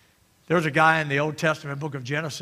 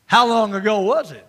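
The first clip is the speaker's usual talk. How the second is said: normal talking voice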